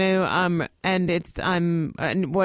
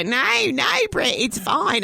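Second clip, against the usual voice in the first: higher, placating Australian voice; Australian accent; 6 semitones higher, 4 dB louder, slower than usual. Retold or imitated. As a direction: soothe the caller in mock Australian. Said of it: "they were Australian".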